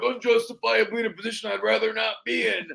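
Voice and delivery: put-on wrestler voice; wrestler promo voice. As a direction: deliver it as a showy wrestling aside. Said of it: "I look at the camera".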